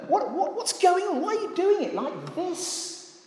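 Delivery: high-pitched, softening murmur of complaint; mock querulous protest; grumbling. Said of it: "They'd started to grumble, to murmur".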